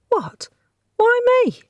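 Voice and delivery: high, loud indignant squeak; startled protest. Acted. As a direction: jolt up in outraged surprise. Kirsten startled at being chosen.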